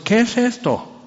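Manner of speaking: mock Lot's wife suddenly alarmed; startled question.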